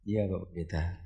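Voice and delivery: low, very soft retold reply; meek patient. Patient replying to the pastor, meek and hushed. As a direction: answer small and subdued.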